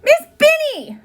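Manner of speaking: high, loud calls to the teacher; a teased girl crying to Miss Binny. A narrator's reading. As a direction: wail for help to the teacher. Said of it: "she cried".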